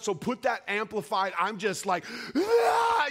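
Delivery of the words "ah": high, loud sustained wail; wailing "ah" at full voice; loud crying wail. Retold or imitated. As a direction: let out a loud, uncontrolled sobbing cry. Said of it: "I'm screaming loud".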